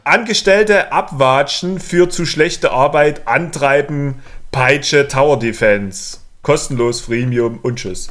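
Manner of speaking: slow deliberate recital. Pretend. Three-sentence summary, exam style.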